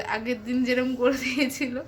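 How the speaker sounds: normal talking voice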